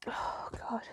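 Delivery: soft, unvoiced gasp to oneself; whispered, choked exhale; overcome with emotion. Real, not acted. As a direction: breathe it out, near tears. Overcome by emotion mid-sentence.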